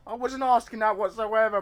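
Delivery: very high, loud protest; outraged yell. Real, not acted. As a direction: shout it high, aghast at the game. Yells at game's misread reply.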